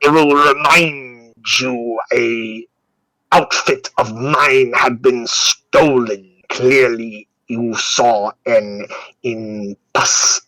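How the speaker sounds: slow, drawn-out doctor character voice; ponderous, deliberate figure defending himself; exasperated doctor. Acted. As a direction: lecture them word by laboured word.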